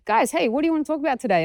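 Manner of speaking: imitated light crowd chat; breezy trainer. Acted breezy turn to seminar audience.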